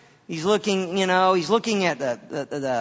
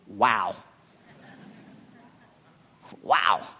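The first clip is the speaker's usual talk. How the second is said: awed wow; 12 semitones lower, 4 dB quieter than usual. Acted. Acting Adam's awed first response.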